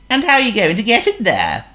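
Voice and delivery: very high squeaky worm. Acted.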